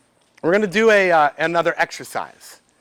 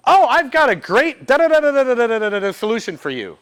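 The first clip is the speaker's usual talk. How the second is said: overeager helper; 4 semitones higher, 3 dB louder than usual. Retold or imitated.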